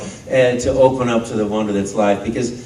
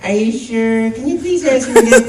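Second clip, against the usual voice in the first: put-on higher pleading kid; imitating a child; 9 semitones higher than usual. The child asking Mario again, a lightly voiced pleading kid. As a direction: beg like an eager little fan. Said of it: "and they say".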